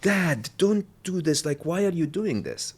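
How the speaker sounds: high, slow mock-whiny plea; pleading kid. Son begs his dad not to call the waiter, mock whiny plea. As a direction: play the embarrassed kid begging Dad to stop.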